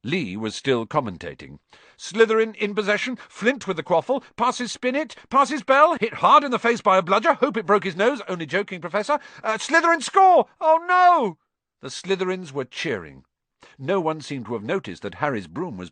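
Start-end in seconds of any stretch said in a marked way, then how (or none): 2.0-11.4 high, loud, fast play-by-play; excited commentator. Acted. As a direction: cheer then groan at the goal. Commentator calling a goal.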